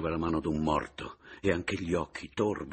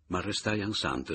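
ordinary delivery, nothing marked

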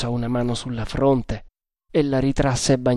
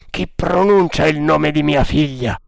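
forceful, stirred question in character; strong emotion; 2 semitones higher, 4 dB louder than usual. A narrator's reading. Captain startled to hear his daughter named, emotional demand. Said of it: "esclamò Macpherson con viva emozione".

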